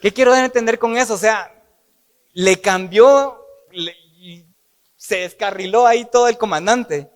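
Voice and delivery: fired-up preacher explaining the twist; excited preaching. Real, not acted. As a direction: build it up with excitement.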